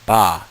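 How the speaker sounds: a loud, clear ba; blended syllable. Shown as a demonstration. Teacher blending the two sounds into ba, loud. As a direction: punch out "ba" as one blended sound. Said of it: "So together".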